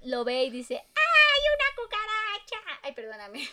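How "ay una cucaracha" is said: shrill squeal far higher, drawn out; mock the boyfriend's squeaky panic; very high-pitched. Imitating the boyfriend's high scream at the cockroach. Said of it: "Es que fue muy agudo".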